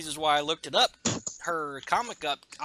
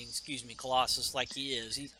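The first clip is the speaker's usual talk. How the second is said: ordinary delivery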